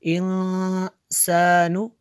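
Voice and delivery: इंसानु slowly with the nasal ghunna on न held. Shown as a demonstration. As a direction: draw the nun of इंसानु through the nose. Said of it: "यहाँ पे गुन्ना किया है". (इंसानु में नून पर गुन्ना, धीरे से)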